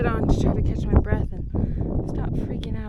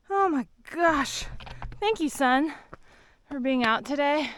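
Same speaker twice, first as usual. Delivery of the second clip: ordinary talk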